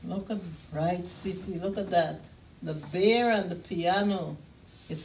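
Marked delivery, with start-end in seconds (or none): none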